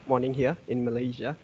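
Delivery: normal talking voice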